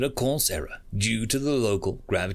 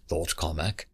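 usual speaking voice

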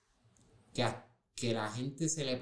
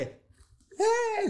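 very high startled "eh"; tongue-tied fan squeak, far higher in pitch; starstruck squeak; 5 dB louder than usual. Retold or imitated. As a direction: squeak like a fan frozen in shock. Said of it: "Y yo como que".

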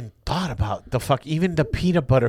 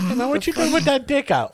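mock voice of the man; 11 semitones higher than usual. Voicing a man and a dog.

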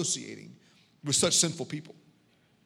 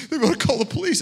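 rising panic; 9 semitones higher, 7 dB louder, much faster than usual. Retold or imitated. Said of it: "She goes".

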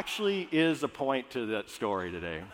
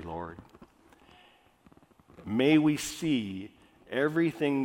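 prayer; 5 semitones lower, 4 dB quieter than usual. Acted. Pastor praying over the congregation, hushed and reverent. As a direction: pray it quietly and reverently, slowing on the praise. Pastor prays before the sermon.

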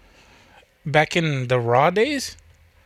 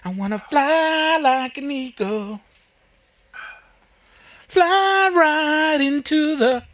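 high, slow, soaring; high dreamy voice; 11 semitones higher, slower than usual. Retold or imitated. Superman dreaming of flying off, in a high slow voice. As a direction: stretch it out high like a hammy hero. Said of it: "And he's like".

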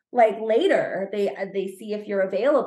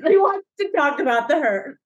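repeated tease, a little louder, at normal speed; lighter tease; 3 semitones higher, 5 dB louder than usual. Pretend. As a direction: toss it off again as a playful jab.

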